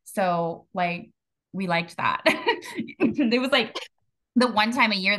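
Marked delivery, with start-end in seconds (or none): 2.3-2.9 breathy high giggle; real giggle at a fond memory; high laugh. Real, not acted. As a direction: break into a short, airy laugh. Laughs at shared birthday memory.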